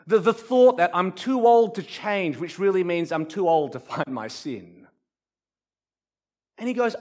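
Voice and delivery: ordinary talk, nothing marked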